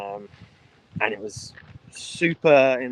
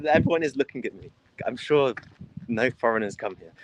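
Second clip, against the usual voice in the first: ordinary talk, nothing marked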